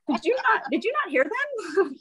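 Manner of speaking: high, laughing did-you-not; laughing at the coach not listening; laughing mock outrage. Real, not acted. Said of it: "playful mock outrage".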